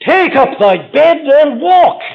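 loud, high, slow command to the lame man; commanding call. Acted. Preacher voicing Christ to the impotent man, a loud command. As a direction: proclaim it like Christ healing the man.

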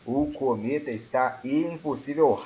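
ordinary talk, nothing marked

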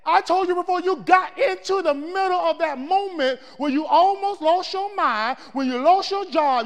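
preaching at full force. Acted. Preacher pressing the congregation, loud and driving. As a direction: build the list and hammer it.

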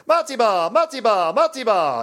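high, repeated game callout; excited arcade voice, each one falling; imitated pinball callout. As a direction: chant it like a pinball machine announcing multiball. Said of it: "Sega, Batman Forever".

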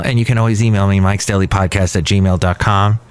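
rattled-off contact details; too fast. Real, not acted. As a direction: race through the email address. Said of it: "You said that so fast".

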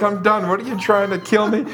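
high-pitched mock outrage; upset. Elderly woman to missionaries after being dunked, mock upset. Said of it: "now she's really upset".

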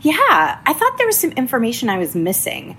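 easy and forgiving, a hint of him; kind agent. Kind agent reassuring her on the phone, lightly voiced. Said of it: "kindest person on the other end".

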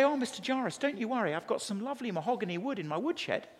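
mock-soothing salesman of a carpenter; reassuring carpenter. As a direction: play a cheerily tactless carpenter. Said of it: "He can say".

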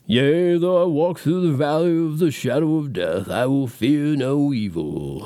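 solemn film-funeral preacher voice, slowing at the end; movie minister reading. Retold or imitated. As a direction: intone it like a graveside minister. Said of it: "some sort of minister, so-called minister, reads the words".

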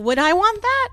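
1 incredulous high question. Retold or imitated. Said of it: "I look at that and I'm like".

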